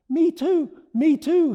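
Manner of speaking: high, loud eager voice; small dragon pleading to come along; eager little dragon. Acted. Small dragon chasing balloon.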